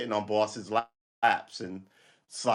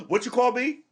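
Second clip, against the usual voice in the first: higher, louder mock outrage; mock offended comeback; 12 semitones higher, 6 dB louder than usual. Pretend victim confronting bully, mock outrage. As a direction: fire back, pretend offended.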